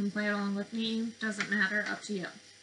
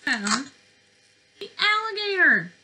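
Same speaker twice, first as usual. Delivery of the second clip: higher, louder, each word spaced out; excited discovery; 8 semitones higher, 9 dB louder than usual. Acted. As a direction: announce the find with a big thrill. Kids' book discovery moment.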